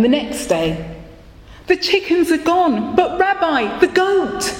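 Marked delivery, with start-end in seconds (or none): 1.7-4.5 high, moaning complaint; poor man moaning to the rabbi about the goat. Acted. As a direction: whine it out like a man at his wits' end. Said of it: "he moaned".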